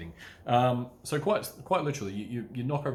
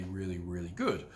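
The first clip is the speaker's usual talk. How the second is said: ordinary delivery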